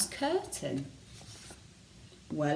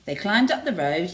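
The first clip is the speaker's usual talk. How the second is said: ordinary delivery, nothing marked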